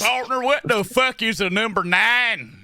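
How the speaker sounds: mock cowboy. Cowboy asking what number nine is, slow mock drawl. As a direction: drag it out like a clueless cowboy. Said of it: "Hey, partner".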